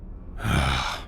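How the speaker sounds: low, soft sigh; heavy exhale, low and quiet; exasperated sigh. Acted. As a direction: let out a long-suffering sigh. Exasperated by captive's answer.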